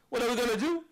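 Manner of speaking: a high, loud rousing question to the crowd; rallying call. Real, not acted. Speaker fires up the conference audience, high and loud. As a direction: pump the room up with this question.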